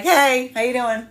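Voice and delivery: breezy, carefree hey; easy breezy. Retold or imitated. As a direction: toss off a breezy hello in passing. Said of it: "I'm getting easy breezy".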